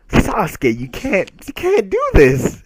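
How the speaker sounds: high, loud overwrought plea; mock outcry. Fan pleads with Sasuke after his harsh line, mock outcry.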